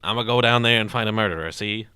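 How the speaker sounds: loud, hammed-up 1930s tough-guy cop; imitating the detective's odd old-timey talk; mock gangster detective. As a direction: bark it like a movie gumshoe, see. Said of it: "he talks like this".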